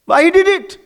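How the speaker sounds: loud high demanding Why he did it; loud question. Shown as a demonstration. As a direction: fire the question at the audience. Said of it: "Tell me why he did it".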